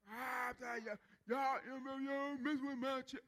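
mock outraged complaint at the window; clowning man. Man clowning at the benefits window, mock outrage. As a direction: ham up a man demanding his check. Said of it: "He clowned".

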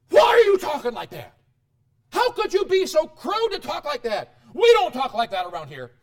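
high, loud mock-outraged scolding; hammed-up parent yelling at the kids; aghast parent. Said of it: "You're aghast at those words your kids are saying".

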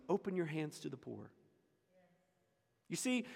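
ordinary delivery

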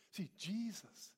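usual speaking voice

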